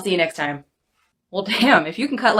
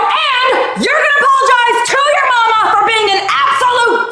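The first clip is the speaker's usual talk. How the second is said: furious yelling; 13 semitones higher than usual. Acted. Scolding the ungrateful boy, furious shouting. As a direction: tear into the kid at full volume. Francine threatens the bratty kid.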